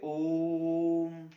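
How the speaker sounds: "ओम" held very long and loud on one breath; long drawn om. Shown as a demonstration. Teacher chanting "ओम" to show a pluta vowel, drawn out. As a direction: stretch "ओम" out, continuous with the breath. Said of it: "बहुत लंबा".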